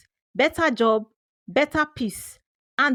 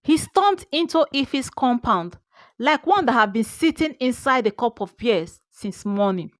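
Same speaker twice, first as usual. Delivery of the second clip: plain reading voice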